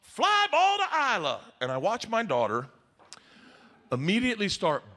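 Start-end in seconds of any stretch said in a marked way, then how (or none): 0.0-1.4 high, projected coach voice; pitched-up singsong coach announcing a drill; coach calling out. Acted. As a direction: call the play across the ball field. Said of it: "Coach is like".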